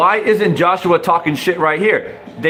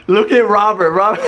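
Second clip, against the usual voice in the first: a laugh spilling into the words; amused, giggling; 4 semitones higher than usual. Real, not acted. Pointing at Robert for viewers, giggling.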